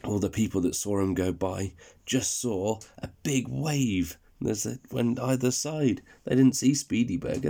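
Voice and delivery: ordinary delivery, nothing marked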